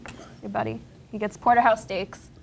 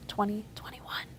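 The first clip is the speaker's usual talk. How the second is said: whisper; 12 dB quieter than usual. Real, not acted. Aside counting under breath.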